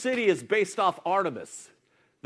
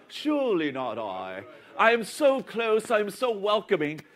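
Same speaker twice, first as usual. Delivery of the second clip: mock British accent. Pastor mimicking the sweet British disciple. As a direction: playfully voice him in British. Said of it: "why he has a British accent".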